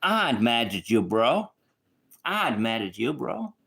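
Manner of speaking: put-on loud, punchy accented "I'm mad at you, bro"; imitated street accent. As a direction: mimic how his crew talks, loud. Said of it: "I'm gonna try to do the accent correctly".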